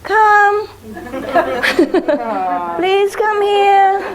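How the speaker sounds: high, slow sing-song calling with laughter; mocking the neighbor's high sweet call; mock sing-song dog call.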